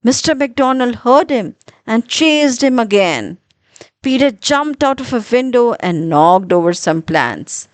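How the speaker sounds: usual speaking voice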